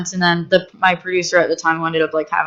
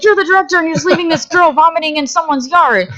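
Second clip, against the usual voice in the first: much higher mock-outraged scolding; high mock scolding crew voice; 9 semitones higher than usual. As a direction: whine it like the whole crew accusing you.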